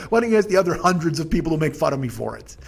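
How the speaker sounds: normal talking voice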